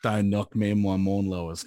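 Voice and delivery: deep mock-threatening bruv voice; mock tough guy. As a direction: warn a mate in fake hard-man tones. Mock-tough reply to teasing.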